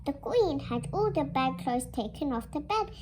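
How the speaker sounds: usual speaking voice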